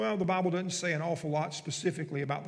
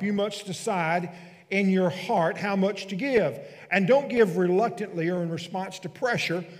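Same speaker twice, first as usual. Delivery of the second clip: scripture reading; 6 dB louder than usual. Acted. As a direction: proclaim the verses to the congregation, weighing each phrase. Reads scripture aloud.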